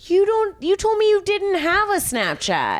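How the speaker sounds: high, loud furious accusation; shrill-free outraged shout at the liar; outraged yell. Retold or imitated. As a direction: catch him in the lie and yell. Furious confrontation with boyfriend.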